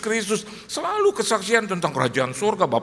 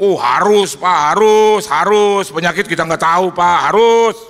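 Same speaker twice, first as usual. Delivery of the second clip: much louder repeated must; loud insistence; 12 dB louder than usual. Retold or imitated. Aliong loudly insists the pastor get checked. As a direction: hammer the warning.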